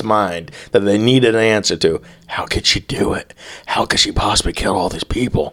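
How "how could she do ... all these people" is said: whispered mock bafflement; hushed disbelief. Baffled courtroom wondering about the killer, whispered disbelief. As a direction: whisper it like a baffled private thought. Acts out everyone's baffled thought.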